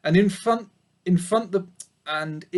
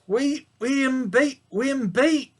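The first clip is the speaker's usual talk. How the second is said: put-on mournful old sheep; 8 semitones higher than usual. A narrator's reading. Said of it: "said Ma mournfully".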